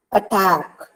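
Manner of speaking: "Attack" with a light first syllable and weight on "tack"; second-syllable stress. Shown as a demonstration. Teacher giving the next example word, "Attack" stressed on "tack". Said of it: "Attack is the part".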